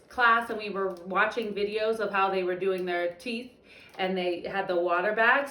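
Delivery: usual speaking voice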